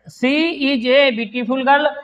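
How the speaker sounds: words drawn out very slowly and evenly; slow dictation. Shown as a demonstration. Teacher dictating the example sentence to the class, very slow. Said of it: "देखिए".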